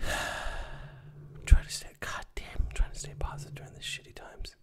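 sighing, whispered and slowed; weary whisper. Acted. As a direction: breathe it out like you're worn out. Exasperated sighing complaint.

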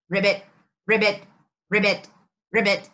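low, short ribbit croaks; playful frog call, low and repeated; frog imitation. Imitates frog for guessing game.

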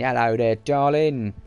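playful Cockney greeting; mock Cockney. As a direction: put on a bit of London Cockney. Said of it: "London's East End".

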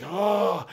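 high, loud imitated cry of horror; hammed-up high "ah" of exasperation; mock outraged cry. Friend watching him bite the burrito again, mock outraged cry.

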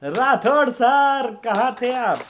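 high, drawn-out call; excited hailing. Real, not acted. Streamer greeting a returning viewer, excited hail. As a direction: hail a long-missed guest, stretching the name.

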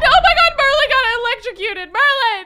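very high, loud shriek of shock; panicked scream. Real, not acted. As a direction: scream in real fright at the accident. Pet electrocuted, panic.

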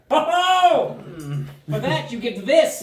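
high, loud jolly exclamation; delighted gnome. Acted. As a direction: burst out like you're awarding a prize. Gnome rewards them with delight.

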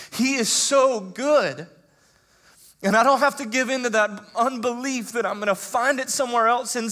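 high, loud preaching; rising sermon fervor; full-force preaching. Acted. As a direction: preach it out to the congregation.

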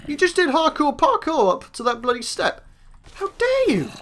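high-pitched playful indignation; mock outrage. Player telling off a too-clever mob, mock outrage. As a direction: scold it in mock offence, pitch leaping up.

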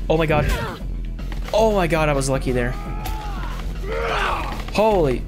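ordinary delivery

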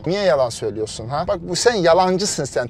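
ordinary delivery

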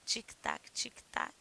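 whispered tic-tac; clock ticking. Shown as a demonstration. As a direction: tick softly like a clock. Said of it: "o tic-tac daqueles relógios". (tique-taque sussurrado)

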